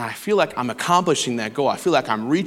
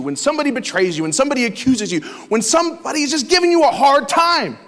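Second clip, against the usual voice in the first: higher and louder, building to a climax; preaching at full force; 6 semitones higher, 5 dB louder than usual. Acted. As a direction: preach it, climbing in pitch and volume.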